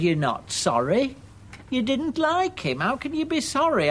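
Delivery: high-pitched, querulous accusation; indignant retort. Acted. Upset mother rejecting her son's apology, indignant retort. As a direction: throw the apology back, voice climbing.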